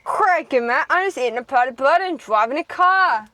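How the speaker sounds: loud, high broad mock Australian; Australian accent. Shown as a demonstration. Sibling topping the silly sentence, loud exaggerated Australian. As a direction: belt out a hammed-up Australian line. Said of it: "The Australian".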